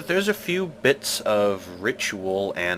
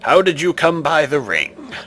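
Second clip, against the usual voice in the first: commanding stone guardian, louder; stone face voice; 5 semitones higher, 8 dB louder than usual. Acted. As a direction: demand an answer like an ancient idol.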